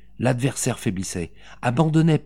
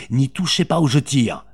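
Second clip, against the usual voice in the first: louder, higher, forceful threat; violent threat; 4 semitones higher, 5 dB louder than usual. A narrator's reading. Mother holding a revolver on the captor, violent threat. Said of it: "reprit Véronique avec violence".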